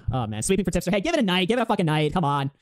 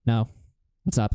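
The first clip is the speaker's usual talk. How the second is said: low, listless imitation; no energy; 9 semitones lower, much slower than usual. Retelling a rival who won't engage, low and listless. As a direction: play the zoned-out caller. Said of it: "no energy, whatever, right?".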